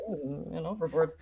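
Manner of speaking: soft, flat bored parrot; deadpan parrot. Acted. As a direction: drop it quietly, unbothered. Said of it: "who acts as my voice".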